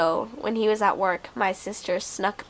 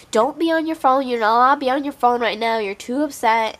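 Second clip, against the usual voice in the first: higher, louder, fast scolding mom; mother shouting orders through a bedroom door, louder and higher; 4 semitones higher, 6 dB louder than usual. Retold or imitated. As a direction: nag her through the door, raised and fast.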